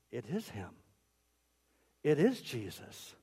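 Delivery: low, soft, dawning recognition; hushed awe. Acted. Women see the risen Jesus, hushed awe. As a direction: barely dare to say it aloud.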